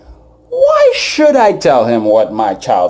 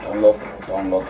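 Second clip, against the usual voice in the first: hushed warning; 3 semitones lower, 7 dB quieter than usual. Retold or imitated. Inner voice warning self.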